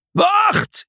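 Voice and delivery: high urgent shout; calling out. Acted. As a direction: shout to stop him. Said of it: "riep Septimus".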